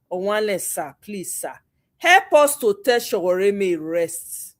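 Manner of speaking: normal talking voice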